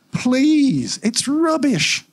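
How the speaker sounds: high, slow, fed-up dismissal; pleading then scornful; exasperated. Acted. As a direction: drag it out in disgust. Exasperated complaint about the hub.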